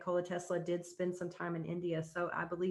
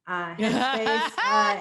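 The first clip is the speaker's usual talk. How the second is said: laughing; 4 semitones higher, 11 dB louder, much slower than usual. Real, not acted. Laughs at spelling out Headspace.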